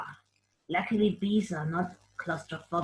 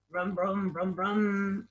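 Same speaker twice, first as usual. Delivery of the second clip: soft imitated engine brum; quiet mock car engine; car engine noises; 5 dB quieter, slower than usual. Shown as a demonstration. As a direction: hum like a car going by. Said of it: "Like that, that".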